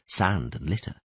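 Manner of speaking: ordinary delivery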